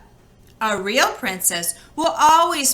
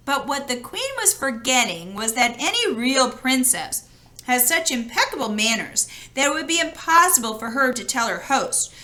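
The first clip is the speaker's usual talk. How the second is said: plain reading voice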